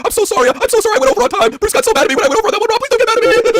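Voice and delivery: high, loud panicky pleading; frantic apology. Acted. Wrestler begging not to be blamed for going over, frantic. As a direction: babble apologies in hammed-up panic.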